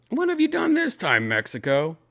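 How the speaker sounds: high mock-exasperated scold. Scolding Mexico in a high mock voice. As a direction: wag a finger at a whole country.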